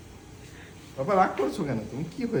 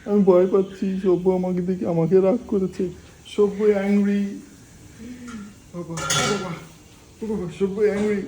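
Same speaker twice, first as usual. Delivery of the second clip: louder mock fright in a childish voice; mock scared baby; 3 semitones higher, 3 dB louder than usual. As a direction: play the frightened little one, hammed up. Voicing the baby, mock fear.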